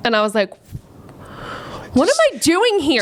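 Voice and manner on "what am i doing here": higher-pitched, mock-panicked question; bewildered disbelief. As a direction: wonder aloud in retold disbelief. Retold bewilderment on arriving in New York.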